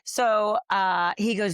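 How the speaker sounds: ordinary talk, nothing marked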